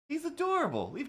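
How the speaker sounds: He's adorable in a squeaky high voice; doting squeal. Real, not acted. Streamer gushing about the cat, very high squeal. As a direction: gush over the cat.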